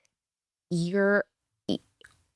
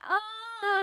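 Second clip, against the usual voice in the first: mock yelp of pain. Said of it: "I'm like".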